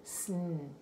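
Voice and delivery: soft hissed "Sn" sound; blend sound. Shown as a demonstration. Teacher isolating the "Sn" blend for the child. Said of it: "the S N blend".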